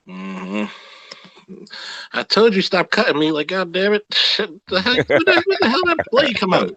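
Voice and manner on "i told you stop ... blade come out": mock cursing woman. Acted angry quote of a woman.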